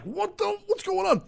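jolted awake, voice shooting up high; startled. Retold or imitated. Woken by footsteps, startled, high-pitched. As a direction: blurt it out in a sudden high panic. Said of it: "I'm like".

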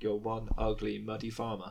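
mock dub line. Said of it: "they'd be like".

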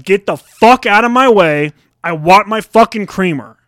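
loud, slow angry demand; pissed off. Acted. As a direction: re-enact the confrontation, hitting it hard and high. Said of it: "And I was pissed".

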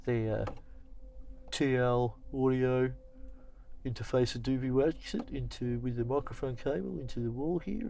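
a light imitation of an old lecturer. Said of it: "mimicking an old lecturer".

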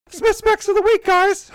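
sung high and loud like a show intro; high announcer jingle voice. Acted. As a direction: belt it like a jingle.

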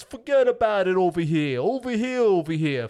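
loud mock Brooklyn Italian accent, hammed up. Mocking the movie's thick Brooklyn accents. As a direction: belt it out like a Brooklyn wiseguy. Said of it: "everybody's accent was like that".

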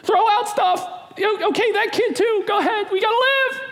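high, frantic panic, voice way up; panicked fear voice. Acted. Preacher acting out fear in the storm, high panicked wail. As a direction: wail it like everyone's doomed. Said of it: "that fear is irrational".

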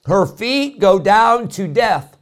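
slow, loud, high proclamation; forceful scripture reading. Acted. Preacher reading the warning verse aloud, slow and loud. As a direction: declare the verse slowly to the pews.

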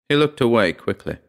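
ordinary delivery

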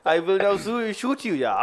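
hammed-up comedy German accent; mock German accent. Acting a Nazi threatening to shoot, mock German accent. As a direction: play a cartoon German with a thick accent. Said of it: "Why is your German accent sounding like".

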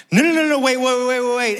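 high, urgent pleading "no, no, wait"; panicked dad. Retold or imitated. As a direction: beg the operator to stop the ride in mock panic. Dad panics as ride starts.

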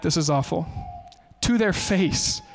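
usual speaking voice

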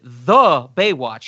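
slow, weighted "the", then Baywatch; stress on THE. Shown as a demonstration. Showing how the character says it, stretched stress on "the". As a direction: hit "the" as its own drawn-out word. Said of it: "He puts the article there".

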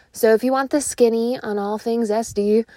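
ordinary talk, nothing marked

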